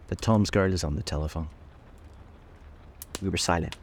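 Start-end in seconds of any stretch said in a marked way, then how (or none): none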